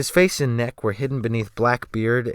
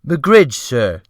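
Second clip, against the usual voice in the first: fawning cook; 3 semitones higher, 7 dB louder than usual. A narrator's reading. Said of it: "he fawned".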